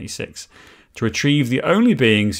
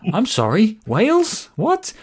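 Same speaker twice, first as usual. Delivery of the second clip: baffled; 11 semitones higher, slower than usual. Retold or imitated. Imitating Ross's baffled reaction.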